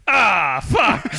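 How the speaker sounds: imitated exasperated yell; retold angry outburst. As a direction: blurt it out like a fed-up buddy. Buddy's outburst in retold shower row.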